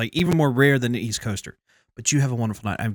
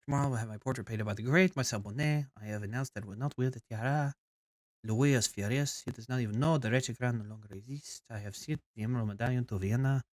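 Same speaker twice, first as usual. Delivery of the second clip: put-on softer French accent with a Spanish tinge; French accent turning Spanish; 3 semitones lower, 6 dB quieter than usual. Queen recounting her portrait plans, Spanish-tinged French accent. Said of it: "Got a little Spanish in there".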